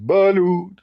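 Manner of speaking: sudden high call of a vendor; street vendor call. Retold or imitated. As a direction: call it out like a beach seller. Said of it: "a guy stand behind me and said".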